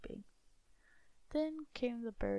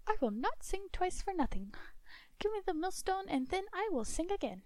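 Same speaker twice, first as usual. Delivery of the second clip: much higher and louder, a bold little bird; high singing bird demanding payment; bird's voice; 10 semitones higher, 5 dB louder than usual. Acted. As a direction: chirp the bargain like a proud bird. Bird bargains for the millstone.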